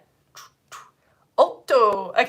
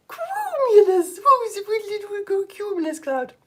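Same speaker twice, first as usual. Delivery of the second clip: silly cloud voice; 10 semitones higher than usual. Shown as a demonstration. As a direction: stretch it out high, sliding down at the end. Teacher acts out a cumulus cloud.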